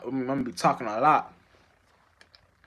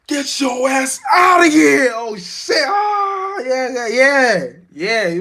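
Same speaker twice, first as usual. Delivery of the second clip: screaming much higher and louder, slowly; furious shout; 12 semitones higher, 11 dB louder, much slower than usual. Acted. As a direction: bellow him out of the house. God throwing churchgoer out.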